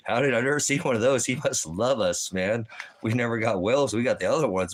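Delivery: high, gleeful talking-dog voice; a dog bragging about bones from Dad, high and excited. Acted. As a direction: play the dog thrilled at Dad's bones.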